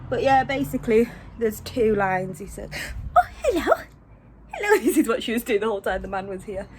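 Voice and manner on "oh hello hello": high, louder coo to the dog. Real, not acted. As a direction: greet the pet in a squeaky voice. Greeting the dog.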